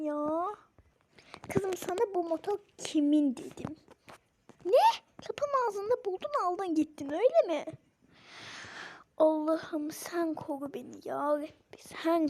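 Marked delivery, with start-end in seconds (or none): 4.6-7.6 shocked. Acted. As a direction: bark out the question in disbelief. Mother shocked about motorbike.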